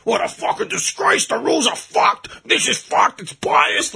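high, hammed-up rant of a sore fan; mock outraged fan. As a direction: ham up the fan crying foul. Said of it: "Australia's out of the World Cup".

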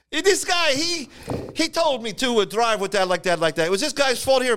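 put-on squeaky, panicked old driver pointing the finger; old Italian driver. Old Italian driver pleading with the traffic judge, high and frantic. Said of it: "But he goes".

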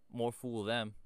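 cheeky, dismissive retort; rude. A narrator's reading. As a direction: throw it back rudely. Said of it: "at once rudely and ungrammatically".

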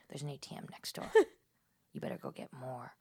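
low whisper. Retold or imitated. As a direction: push them quietly to the ATM. Psychic demanding more money.

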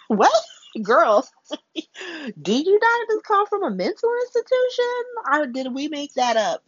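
high, squealing disbelief; incredulous co-workers. Retold or imitated. Co-workers question Liz back from the institution, high and incredulous. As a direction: gasp out the question in shock. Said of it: "Britt and Terry are like".

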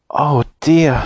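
lightly sobbed oh dear; mild sob. A narrator's reading. Silky sobs to the children, a hint of tears. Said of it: "sobbed Silky".

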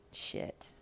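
breathy whispered shit; whispered curse. Real, not acted. To viewers after the huge sum, a whispered curse. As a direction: drop to a whisper, stunned. Reacting to the alimony total.

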